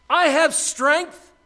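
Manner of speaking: very high, slow, loud proclamation; shouting out. Acted. Wisdom boasting of herself to the street, shouting out. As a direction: belt it out to the street. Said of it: "I'm shouting out".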